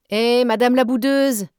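sneering schoolboy shout; mocking shout. A narrator's reading. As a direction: yell it across the room to mock her. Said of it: "cria la voix de John Reed".

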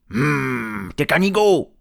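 angry troll. Acted. Léon insulting Tom, loud anger. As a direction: hum rising into a loud, high insult. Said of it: "trois trolls en colère".

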